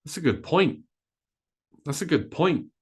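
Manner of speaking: "point" punched high and long, "good" lighter; stress on point. Shown as a demonstration. Teacher modelling sentence stress to learners, stress on "point". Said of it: "We stress point".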